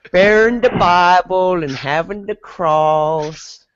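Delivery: drawn-out hammed-up Southern US twang; Southern US accent. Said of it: "He's like".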